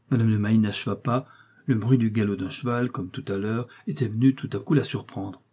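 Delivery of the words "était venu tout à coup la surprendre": ordinary delivery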